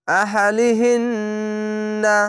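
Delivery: very slow আহালিহিন্না with a long held nasal ন্ন; nasal ghunna. Shown as a demonstration. Teacher shows the obligatory ghunna on আহালিহিন্না. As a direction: hum through the ন্ন of আহালিহিন্না slowly. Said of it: "এখানে ওয়াজিব গুন্না".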